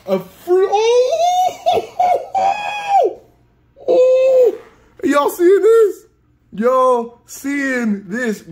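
very high, loud shrieking joy with laughter; hooping and hollering. Real, not acted. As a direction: shriek it like you can't believe it. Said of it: "sorry for all the screaming and the hooping and hollering".

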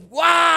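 high, sudden imitated demon cry; infernal scream. Possessed man screams during the preaching, high imitated cry. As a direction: imitate a startling possessed shriek. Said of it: "dio un grito".

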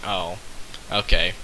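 normal talking voice

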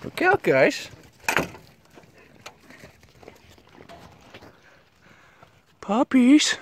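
high, squeaky call to puppies; cutesy pet talk. Real, not acted. Vlogger calling to a litter of puppies, cutesy high voice. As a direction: coo it up high to the pups.

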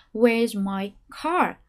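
very slow "Where's my car?" stepping down, rising on "car"; staircase intonation. Shown as a demonstration. Teacher models the full staircase question. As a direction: step down and lift on "car". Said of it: "Đến từ car ... thông tin mới".

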